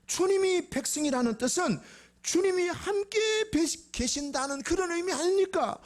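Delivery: high-pitched impassioned pleading as Moses; impassioned plea. Acted. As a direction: plead urgently with God at full pitch. Moses pleading with God, acted. (높고 간절하게 호소하듯)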